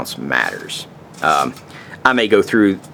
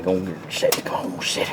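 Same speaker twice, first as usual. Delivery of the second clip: hushed, breathy coaxing of the flame; coaxing whisper; 7 dB quieter than usual. Real, not acted. Coaxing his stove fire to light, whispered. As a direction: whisper to the fire to catch, like calming a dog.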